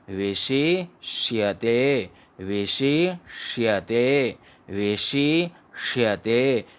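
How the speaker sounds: विशिष्यते drawn out slowly, each syllable clear; teacher repeating विशिष्यते slowly for students; slow syllable demo. Shown as a demonstration. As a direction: sound out विशिष्यते very slowly, ष crisp. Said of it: "आगे जो श आधा है".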